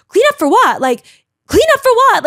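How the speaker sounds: high, loud outraged question; indignant outcry. Retold or imitated. Diner protesting servers clearing her plate, loud outrage. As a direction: protest at the top of your voice. Tablecloth pulled away mid-meal.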